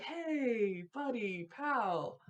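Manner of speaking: soft, gentle calming voice; soothing a big angry guy, soft and calm. Acted. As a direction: talk him down slowly and gently. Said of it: "kinda, like, slowly, like, calmly approaching".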